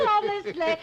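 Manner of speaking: laughing. Real, not acted. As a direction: laugh out the word. Laughing at husband's joke.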